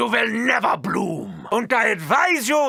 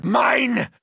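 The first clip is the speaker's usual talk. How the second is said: ordinary talk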